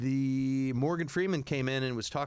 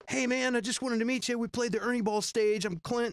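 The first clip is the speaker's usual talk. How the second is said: eager fan pitch; 8 semitones higher than usual. Retold or imitated. Young fan meets [name].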